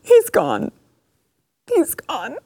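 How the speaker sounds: very high, squeaky fake cry; mock sobbing. Fan mourning the candidate's exit, mock sobbing. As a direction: whimper it, hammed-up and heartbroken. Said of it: "[name], have your moment".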